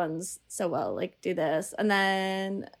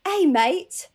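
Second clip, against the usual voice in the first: mock British accent, higher and louder; Don Cheadle's British accent; 8 semitones higher, 6 dB louder than usual. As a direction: imitate a dodgy British accent. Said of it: "he's not very good at the British accent".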